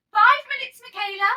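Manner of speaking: loud high backstage call. Acted. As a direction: call her name across the wings.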